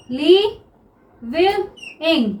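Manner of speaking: living stretched very slowly, each syllable held; drawn-out word. Shown as a demonstration. Teacher showing students living, very slow. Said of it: "teacher drawing out the word".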